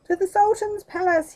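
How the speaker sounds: high, loud call to the palace; rally the horse forward with a shout; ringing cry as he rides off. Acted. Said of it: "he cried".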